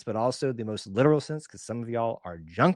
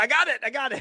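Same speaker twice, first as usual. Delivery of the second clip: high, loud mock cry of triumph; mock eureka; 13 semitones higher, 4 dB louder, faster than usual. Host teasing co-host who will find his punchline, high mock triumph. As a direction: squeal it like you finally cracked the joke. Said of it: "he's gonna be".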